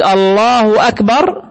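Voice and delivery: slow, lifted "الله أكبر" showing the error; wrong pronunciation. Shown as a demonstration. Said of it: "غلط". (نطق خاطئ بطيء مرتفع لـ"الله أكبر")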